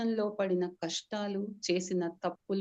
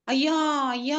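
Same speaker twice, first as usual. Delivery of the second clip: higher, louder grieving wail; wailing cry; 6 semitones higher, 7 dB louder, faster than usual. Acted. Servant wails over master's death, loud grieving cry. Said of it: "గట్టిగా అరుస్తూ తల బాదుకుంటూ ఏడవసాగాడు".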